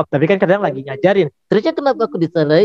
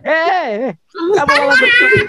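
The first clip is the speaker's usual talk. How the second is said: puppet voice; 10 semitones higher than usual. Acted. Host startled by puppet.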